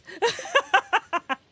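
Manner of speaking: high, loud burst of laughter. Real, not acted. Telling a story about a friend, high loud laugh. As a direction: laugh out loud in a rapid run.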